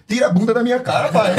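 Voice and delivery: high-pitched retold child's complaint; mock indignant protest. Daughter objects to dad's bottom in her face, mock indignant.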